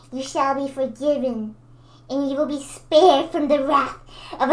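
slow, loud, commanding villain; villain impression. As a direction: command your subjects like a dark lord. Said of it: "That's my villain".

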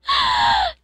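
sharp shrieking gasp in shock; piercing high gasp; loud high gasp. Real, not acted. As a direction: gasp hard in horror. Sees bad pull.

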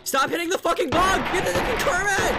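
very high, loud squealed yell; high-pitched shriek. Real, not acted. Player yelling at a bug in the game, high-pitched shrieking. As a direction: shriek it far above your normal voice. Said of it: "did that so high-pitched".

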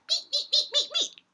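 very high squeaky beeps; high playful pulley noises; squeak imitation.

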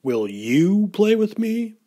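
eager little storybook shark; friendly shark asking. A narrator's reading. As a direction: ask sweetly, lifting up high at the end. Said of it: "he asked Angelfish".

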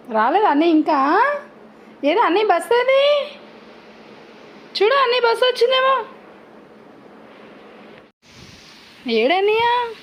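asking the dog if the school bus is here, excitedly; excited pet talk. Real, not acted. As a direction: get the dog excited, quick and bright.

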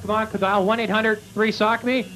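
usual speaking voice

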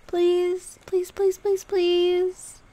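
high, loud run of pleading pleases; begging. Acted. Girlfriend begging him to say hi to the dog, high and loud. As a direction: beg over and over like a child.

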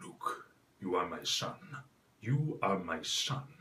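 low, soft, slow menacing Sith lord; deep villain declaring fatherhood; Darth Vader voice. Shown as a demonstration. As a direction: rumble it from the back of the throat. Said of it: "talk like Darth Vader".

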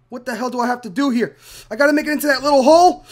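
ordinary talk

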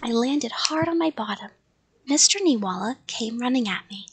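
plain reading voice

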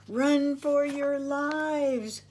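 high, drawn-out call of alarm; slow, high storybook panic; alarmed cry. A narrator's reading. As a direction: stretch out a warning to flee, pitched high. Boys fleeing aliens in story.

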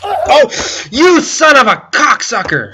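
high, loud cry of rage at the fall; cursing the game at full voice; furious yell. Real, not acted. Falls in the game, yells.

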